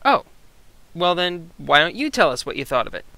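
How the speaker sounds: ordinary talk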